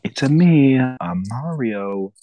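put-on loud start sagging to a slow, accentless finish; mock a half-hearted attempt at the catchphrase; Chris Pratt as Mario. Mocking the movie actor's Mario, no real Italian accent. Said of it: "Chris Pratt literally was like".

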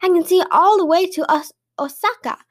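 excited, showy calling out; mild shout. A narrator's reading. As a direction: call out so the eavesdropper hears. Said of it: "continued to shout".

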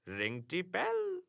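slow, soft, grinning; grinning taunt. A narrator's reading. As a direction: smirk it out slowly. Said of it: "attempting a grin".

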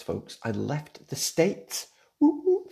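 high whoop of celebration; playful mock celebration; mock cheer. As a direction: cheer like a party. Joking cheer.

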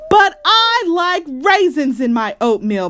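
put-on high, loud child's protest. A narrator's reading. Sister to Mama about raisins, loud protest. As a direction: wail the protest, starting high. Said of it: "protested Sister".

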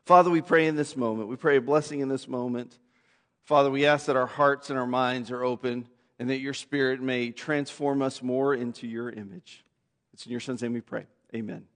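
soft, low, reverent prayer voice. Acted. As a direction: pray quietly over the church. Leads a closing prayer.

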